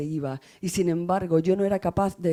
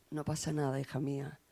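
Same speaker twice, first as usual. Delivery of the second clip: soft reassurance; 3 semitones lower, 9 dB quieter than usual. Real, not acted. Mother soothing her daughter.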